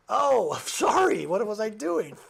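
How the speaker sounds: loud, high mock apology; over-the-top fake contrition; sarcastic apology. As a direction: exaggerate the sorry, drawing it out. Sarcastic apology to ungrateful person.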